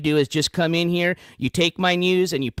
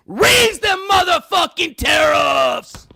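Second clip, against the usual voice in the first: slow drawn-out shout; 10 semitones higher, 6 dB louder, much slower than usual. Real, not acted. Repeats the shout slowly.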